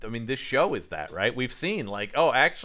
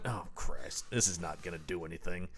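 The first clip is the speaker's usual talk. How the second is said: low, much softer, resigned grumble; defeated mutter; 7 semitones lower, 10 dB quieter than usual. Retold or imitated. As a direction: grumble it to yourself, already beaten. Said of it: "he's just like".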